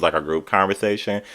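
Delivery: ordinary delivery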